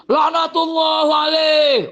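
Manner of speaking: mocking how the Shia curse Abu Bakar. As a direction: spit it out in parody. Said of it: "orang Syiah itu ngomongnya begitu".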